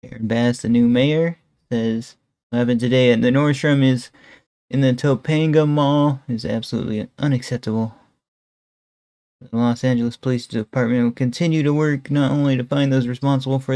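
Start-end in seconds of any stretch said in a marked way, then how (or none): none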